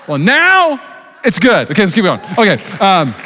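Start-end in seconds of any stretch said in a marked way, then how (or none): none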